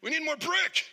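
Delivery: very high mimicked bricklayer's holler; yelling. Bricklayer demanding supplies all day, imitated yell. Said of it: "him all day yelling".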